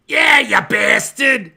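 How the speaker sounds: slow, loud shouted jab; Paul answering the call with a loud slow jab; gruff friend voice. Pretend. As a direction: bark it back at your buddy. Paul's reply on phone.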